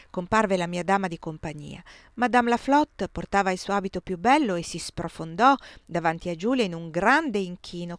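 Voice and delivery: ordinary delivery, nothing marked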